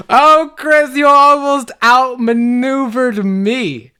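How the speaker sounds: loud, high, drawn-out mockery; gloating villain. Evil role mocking Chris's failed attempt, theatrical gloat. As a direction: taunt theatrically, stretching each word.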